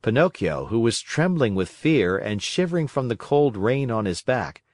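ordinary delivery

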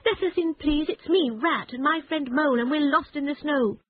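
high, urgent pleading; crying out. A narrator's reading. Rat begging Badger to open the door. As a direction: beg to be let in out of the cold. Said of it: "cried the Rat".

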